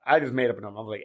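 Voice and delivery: ordinary talk, nothing marked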